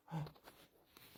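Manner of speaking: high, breath-only mock gasp; staged gasp of alarm; sharp fake gasp. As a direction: gasp as if startled by something. [name] feigns shock at skin.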